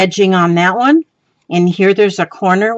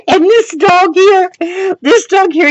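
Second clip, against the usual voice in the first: laughing; 11 semitones higher than usual. Real, not acted. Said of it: "I'm dying over here laughing".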